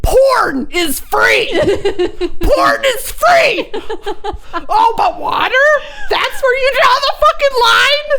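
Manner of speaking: high, slow, shouted mock outrage; hammed-up furious yell at the barista; imagined scream. Barista refuses her water, so she rants in a high slow mock yell. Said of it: "I wanted to scream in her face".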